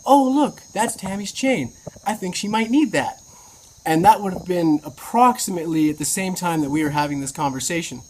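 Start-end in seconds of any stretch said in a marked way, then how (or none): 0.1-3.2 lightly voiced breezy friend, pitched high; a hint of a chirpy woman spotting something; friend's voice. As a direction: friend offering the chain, lightly mimicked in a high voice. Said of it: "She was just like".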